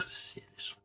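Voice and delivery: breathless whispered disbelief; exasperated whisper. Real, not acted. Reviewer despairing at the missing bitterness, whispered. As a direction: hiss it under your breath in disbelief. Exasperated aside after pause.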